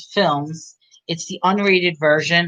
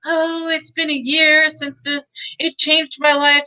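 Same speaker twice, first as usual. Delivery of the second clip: mock diary girl; 7 semitones higher than usual. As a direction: read it like a sappy diary entry. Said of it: "the girl is writing in her diary".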